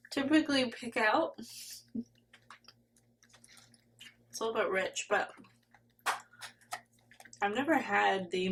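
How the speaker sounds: talking while chewing; words round a bite of candy; mouth full. Real, not acted. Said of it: "Sorry, I'm eating".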